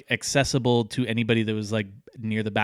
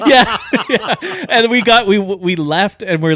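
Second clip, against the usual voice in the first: laughing; 10 semitones higher, 10 dB louder than usual. Real, not acted. Still laughing after story.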